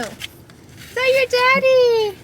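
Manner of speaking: very high sweet puppy talk. Real, not acted. Talking to puppy.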